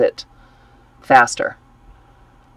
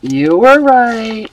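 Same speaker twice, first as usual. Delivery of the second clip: slow, drawn-out higher mock concession; mock grudging; 6 semitones higher, 3 dB louder, much slower than usual. Real, not acted. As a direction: stretch it out like a grudging confession. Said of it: "I hate saying it".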